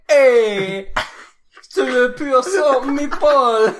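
loud high hammed-up French shout; french chef. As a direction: yell it like a silly French chef. Shouting French bit, laughing.

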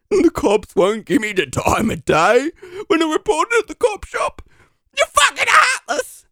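wailing, pitch soaring into a high yell; crying outrage. Acted. Applicant accusing interviewer of being heartless, crying outrage. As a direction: wail the grievance, building to a shriek. Breakdown over unhelpful cops.

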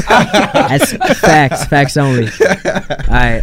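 talking through laughter; laughing. Real, not acted. Laughing while agreeing.

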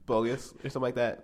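ordinary delivery

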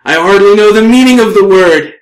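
loud, high comic elf retort; haughty elf. Acted. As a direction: play an affronted elf, amused underneath. Game master answers as the elf.

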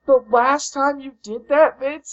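high, loud anguished no; dismayed cry. Real, not acted. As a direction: wail at the bad news. Dismay at booking news.

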